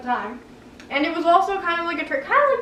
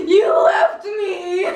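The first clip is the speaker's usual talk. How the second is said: slow, loud, higher cry through laughter; mock angry wail; 4 semitones higher, 4 dB louder, much slower than usual. Girl climbing out to her dad, crying out accusingly. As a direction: hurl the accusation like a wounded kid. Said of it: "was so upset and so mad".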